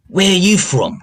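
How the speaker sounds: high, wary prisoner asking the orc; startled prisoner. Acted.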